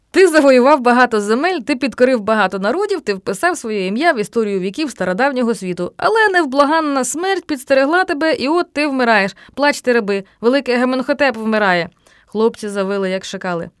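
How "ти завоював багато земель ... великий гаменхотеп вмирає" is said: loud ceremonial declamation; grandiose oration. A narrator's reading. Boy eulogizing his friend playing a dying pharaoh, grandiose.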